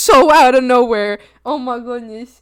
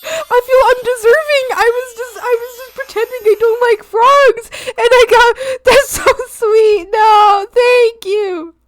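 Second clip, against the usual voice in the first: mock crying; 9 semitones higher, 4 dB louder than usual. Overwhelmed by generous subs.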